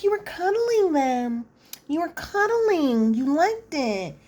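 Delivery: high, drawn-out teasing imitation; exaggerated singsong high voice; mock accusation. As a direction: stretch it high, teasing him as his fiancée.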